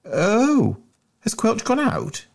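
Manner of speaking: high, caught-out squeak; squeaky schoolboy. Acted. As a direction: sound caught out and flustered. Bunter caught out.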